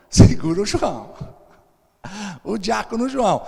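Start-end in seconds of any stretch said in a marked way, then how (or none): 0.1-1.1 louder, laughing through it; laughing repeat. Acted. Repete a ordem rindo. (repetindo a ordem às gargalhadas, mais alto)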